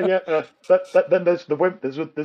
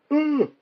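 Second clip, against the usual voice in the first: high drawn-out ooh of a wince; wincing ooh; 12 semitones higher than usual. Retold or imitated. Watching a driver clip the buffers, high wincing ooh. Imitating truck hitting buffers.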